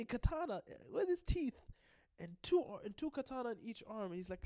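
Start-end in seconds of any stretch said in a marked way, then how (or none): none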